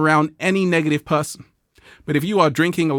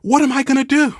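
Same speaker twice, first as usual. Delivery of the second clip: mock panic; 10 semitones higher, 3 dB louder, faster than usual. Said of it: "I'm like".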